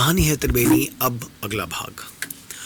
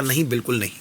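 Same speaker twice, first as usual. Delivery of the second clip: ordinary delivery, nothing marked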